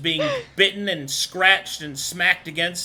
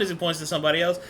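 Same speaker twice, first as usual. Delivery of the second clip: ordinary talk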